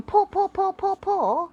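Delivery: high, sing-song paw chant; the dog mimics its owner praising it; dog imitating owner's praise. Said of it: "by going".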